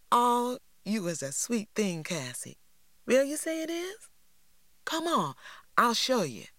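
put-on high, sugary girl voice; sweetly condescending; high prissy girl voice; Lily's voice. A narrator's reading.

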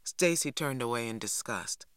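plain reading voice

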